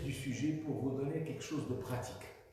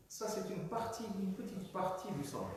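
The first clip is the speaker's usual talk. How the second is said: usual speaking voice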